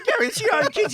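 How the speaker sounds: loud, high pleading cry; exasperated plea. Retold or imitated. Agent pleading with Gary to sign for his kids, loud. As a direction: beg him in disbelief.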